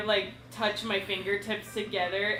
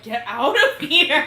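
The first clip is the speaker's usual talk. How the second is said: loud, slow "Get out of here!" with a laugh; shout at the dog; 5 semitones higher, 9 dB louder, slower than usual. Retold or imitated. Owner yelling at the dog on the sofa, laughing. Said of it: "and I'm like".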